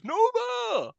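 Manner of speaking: very high, loud whooping cry; drawn-out falsetto-like yell; mock shouted call. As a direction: holler the nickname out in a high voice. Imitating Stifler calling out running.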